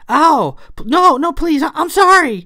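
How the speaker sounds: very high, loud pleading; panicked cry. A narrator's reading. Clara knocked down by Thomasina, crying out in panic. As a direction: stammer and cry out for mercy. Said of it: "Clara cried".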